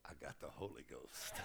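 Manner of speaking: quiet, low, half-laughing explanation; sheepish quiet. Retold or imitated. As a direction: mumble it sheepishly with a chuckle. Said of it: "he said".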